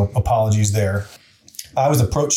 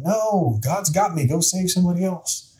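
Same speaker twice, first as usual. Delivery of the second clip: higher, lightly voiced refusal; stubborn believer refusing; 8 semitones higher than usual. Man on the roof refusing the helicopter, higher voice. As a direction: wave the rescuers off, pitched up. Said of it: "And the guy's like".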